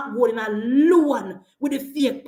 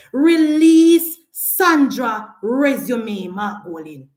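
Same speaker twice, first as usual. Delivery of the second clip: loud, slow, drawn-out demand; slow public challenge at volume; forceful demand; 3 semitones lower, 4 dB louder, much slower than usual. Real, not acted. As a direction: call it out word by word. Public demand to political rival.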